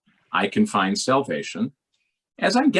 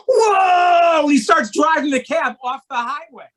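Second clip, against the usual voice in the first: high loud yelp of alarm; panicked shout; 9 dB louder than usual. Retold or imitated. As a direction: shout as the cab lurches. Cab swerves off highway.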